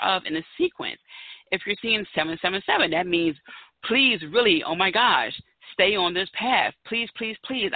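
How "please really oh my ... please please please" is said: earnest, higher pleading please; pleading emphasis. Real, not acted. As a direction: beg them to stay on the path. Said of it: "I can't stress this enough".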